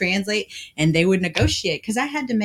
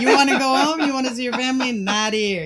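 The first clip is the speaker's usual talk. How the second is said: retold indignant rant, louder and higher; mad protest; 4 semitones higher, 6 dB louder than usual. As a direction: act out being fed up, building to loud protest. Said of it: "And I was so mad".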